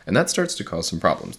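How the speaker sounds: normal talking voice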